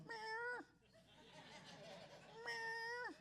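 high, soft pleading meow; coaxing meow. Shown as a demonstration. Pastor coaxing the stray out of the garage, high soft meow. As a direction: call the cat sweetly. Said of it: "standing in my front driver going".

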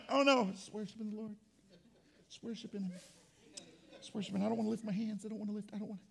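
soft, low, self-conscious murmur; timid excuses. Acted. Embarrassed worshipper explaining himself outside church, soft and timid. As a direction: shrink away, barely audible.

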